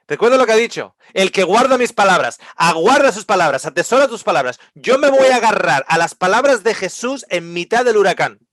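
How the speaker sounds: rally the listeners with conviction; full-force preaching. Acted. (predicación ferviente en voz alta)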